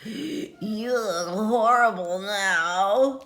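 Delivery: a monster drawling a rude remark; slow storybook monster voice. Acted. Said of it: "the monster says".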